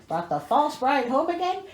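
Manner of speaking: usual speaking voice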